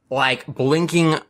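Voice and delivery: usual speaking voice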